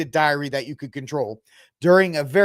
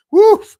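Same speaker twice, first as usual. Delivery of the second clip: sudden high shouted woof; a yelped sarcastic woof; loud mock woof; 14 dB louder than usual. Real, not acted. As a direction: bark it out in mock disbelief. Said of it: "mock reaction".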